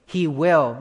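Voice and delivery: "will" hit loud; stress on will. Shown as a demonstration. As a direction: punch the word "will". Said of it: "not he may".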